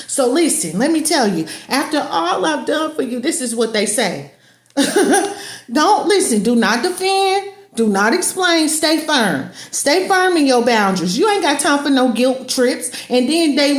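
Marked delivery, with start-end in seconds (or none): none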